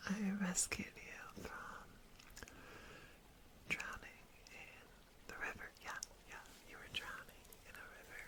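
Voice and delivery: soft, close whisper to the listener; ASMR whisper. Acted. To the rescued stranger, a soft reassuring whisper. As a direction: soothe them with a gentle hush.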